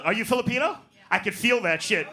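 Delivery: normal talking voice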